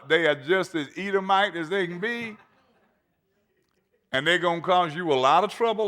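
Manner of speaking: high, loud, hammering sermon voice; full-force preaching. Acted. Preacher driving the point home to his church, fervent. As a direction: preach it to the back row.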